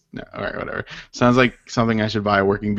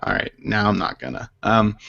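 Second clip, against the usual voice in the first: ordinary delivery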